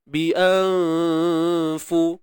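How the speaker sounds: slow, drawn-out tajwid chant; Quran recitation. Shown as a demonstration. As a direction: recite it slowly, each sound held. (bacaan tartil perlahan)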